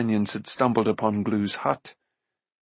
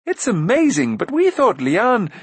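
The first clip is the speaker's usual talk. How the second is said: high, loud excited blurting; amazed prince; 10 semitones higher, 5 dB louder than usual. A narrator's reading. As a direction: gush in wonder, then break off. Eager prince blurts, gets cut off.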